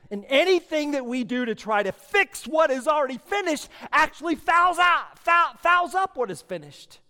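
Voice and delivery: loud, high shouted exhortation; full-force preaching. Real, not acted. Preacher shouting at the church, full force.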